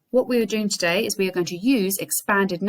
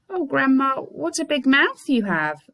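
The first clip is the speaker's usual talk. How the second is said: higher and slower, dawning fear; alarmed exclaim; 7 semitones higher, 4 dB louder than usual. Acted. The girl seeing grandma's huge mouth, slow and alarmed. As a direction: stretch it out with growing alarm.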